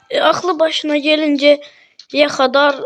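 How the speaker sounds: normal talking voice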